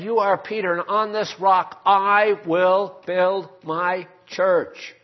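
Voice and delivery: loud, slow, building proclamation; proclaiming. Retold or imitated. Preacher thundering Jesus's promise to Peter, loud and slow. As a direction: drive home every word of the promise.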